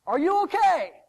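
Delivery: loud, high call from afar; yelling out. Acted. Young son yells out to a stranger digging, calling loudly. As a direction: holler it out with concern. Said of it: "yells out".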